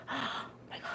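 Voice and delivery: gasping, hushed oh my God; awed whisper. Retold or imitated. Seeing pretty Venezuelan cheese, awed whisper. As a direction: breathe it out in awe.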